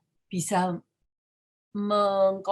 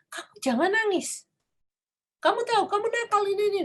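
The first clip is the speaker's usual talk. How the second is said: high, fast nagging scold; mock-scold a crying child; scolding parent; 13 semitones higher than usual. Parent scolding a crying child, high and rushed. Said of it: "kita memarahin dia".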